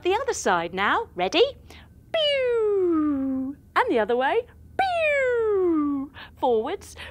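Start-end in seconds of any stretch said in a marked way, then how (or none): 2.1-3.6 playful zap noise; quick cartoon laser pew; laser pew sound. Testing the steering controls. 4.8-6.1 playful zap noise; quick cartoon laser pew; laser pew sound. Testing the steering controls.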